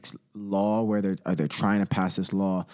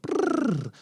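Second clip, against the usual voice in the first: creepy shiver; 10 semitones higher than usual. Acted. Shiver at creepy occult imagery.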